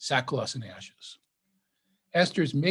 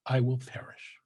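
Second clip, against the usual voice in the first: hushed, softer reading of Esther; hushed resolve; 3 semitones lower, 5 dB quieter than usual. A narrator's reading. Esther answering Mordecai before risking her life, hushed resolve. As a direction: drop almost to a whisper.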